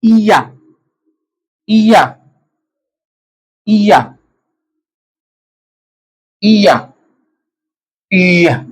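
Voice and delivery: इया spoken slowly as ee-ya, each syllable clear; teacher modelling इया as ee-ya for students; Swedish pronunciation demo. Shown as a demonstration. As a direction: spell out इया as a careful two-syllable ee-ya. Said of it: "इन अल्फाज को आपने ऐसे पढ़ना है".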